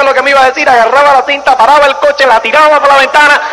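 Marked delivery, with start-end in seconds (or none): none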